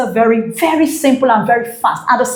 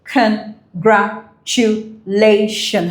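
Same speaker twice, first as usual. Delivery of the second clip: very slow, each syllable its own puff; much slower than usual. Shown as a demonstration. Teacher counting syllables for students, syllable by syllable. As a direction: break CONGRATULATIONS into separate syllables with gaps. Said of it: "in a puff of breath".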